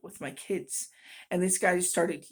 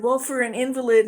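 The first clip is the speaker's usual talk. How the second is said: high, loud sneering jab; mocking stranger's jab; 5 semitones higher, 11 dB louder than usual. Stranger sneering at her cane, loud mock jab. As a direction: throw the insult out loud and high. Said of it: "And then he said".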